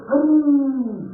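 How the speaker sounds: high, loud, drawn-out call; ecstatic cry. Retold or imitated. Neighbour imitating the husband calling to God, ecstatic cry. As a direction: cry out the holy name, stretching it.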